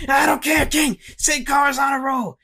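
high-pitched, hollered commentator imitation; Booker T. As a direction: brush off the objection with excited yelling. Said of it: "then Booker T changed his pick".

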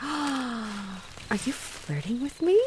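mock-scandalised question, drawn out; mock shocked gasp. Mock gasp at his flirting.

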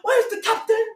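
shrill-sounding squeal calling for the captain; high mock panicky passenger. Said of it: "they started saying".

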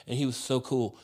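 normal talking voice

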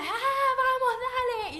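high, loud pep shout; cheering the class on, imitating; imitated coach shout. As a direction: yell it like a fired-up fitness instructor.

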